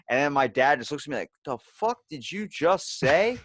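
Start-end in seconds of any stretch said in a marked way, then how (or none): 1.5-3.4 slow, high-pitched imitation of disbelief; appalled dad. Dad reacts to what his son said, appalled imitation. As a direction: stretch out the mock outraged question.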